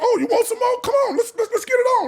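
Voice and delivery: loud, very high imitation of mom squaring up; shouted retold dare, fired up; fighting challenge. Mother daring the beaten boy to fight on, fired up.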